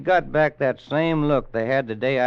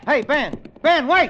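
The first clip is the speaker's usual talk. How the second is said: loud, higher shout across the yard; calling out; 10 dB louder than usual. Acted. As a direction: yell after the boy riding off. Calling after boy riding off.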